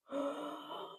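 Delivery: a sharp, high gasp for air; imitated gasp. A wife imitating her dying husband's gasp. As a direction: gasp in suddenly, the way he did. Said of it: "I heard a".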